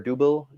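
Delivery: "double" said with an OO, as DOO-bul; alternative pronunciation. Shown as a demonstration. Brewer offering another name for his Belgian ale, "double" as DOO-bul. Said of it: "depending on how you pronounce".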